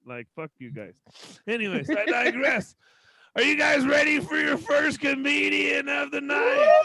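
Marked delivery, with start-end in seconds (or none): 3.3-6.8 loud, high announcer call; host hype. Real, not acted. Host hyping the crowd before the first comic. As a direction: pump the crowd up. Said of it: "host hyping crowd".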